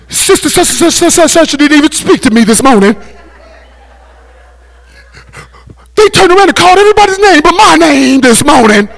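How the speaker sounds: mock complaining saint, rising to a teary whine; whiny church member. Preacher mimics offended saints to the congregation, mock teary whine. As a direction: play a hurt churchgoer whining about being ignored.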